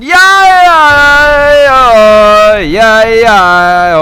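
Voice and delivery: loud, far mock yell; shouting yeah. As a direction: bellow yeah over and over. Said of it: "you're going".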